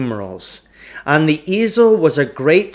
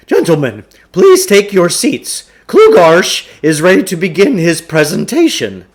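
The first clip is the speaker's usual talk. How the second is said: higher, louder, self-important showman voice; pompous huckster; 4 semitones higher, 6 dB louder than usual. Acted. Huckster urging the boys to stay, grandly pompous. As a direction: puff yourself up like a carnival salesman.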